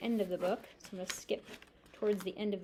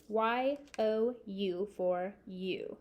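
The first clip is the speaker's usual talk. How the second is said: slow spelling; much slower than usual. Shown as a demonstration. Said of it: "spelling aloud slowly".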